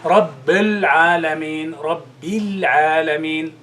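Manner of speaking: Rabbil Alameen slowly, with the kasra in Rabbil held clear; slow correction. Shown as a demonstration. Teacher slows the word so students hear the kasra. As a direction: stretch each sound in Rabbil. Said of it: "you notice the difference".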